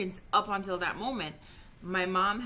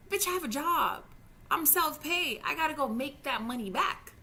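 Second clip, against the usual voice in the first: higher, louder indignant outburst; real outburst; 8 semitones higher, 5 dB louder than usual. Real, not acted. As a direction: burst out, fed up and furious. Venting anger over surgery delay.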